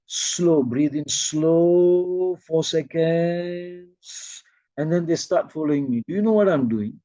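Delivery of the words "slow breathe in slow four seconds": very slow, long-held vowels; longer, slower cue. Shown as a demonstration. Teacher lengthening the group's breath, very slow and drawn-out. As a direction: stretch SLOW out across a whole breath. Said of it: "make it a bit longer, slower now".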